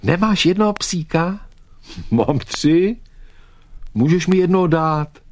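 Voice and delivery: high, shouted exchange across a yard; loud calling. Acted. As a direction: call each line out over a distance. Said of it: "odpovídal Vítek nahlas".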